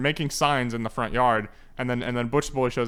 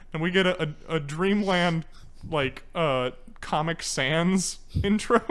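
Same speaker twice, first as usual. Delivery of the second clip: ordinary talk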